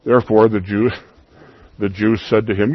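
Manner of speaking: ordinary talk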